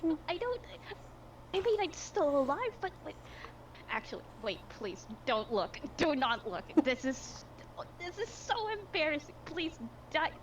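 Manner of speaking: put-on high whining baby voice; whiny man child. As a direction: whine it like a big baby. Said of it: "whining like a little baby".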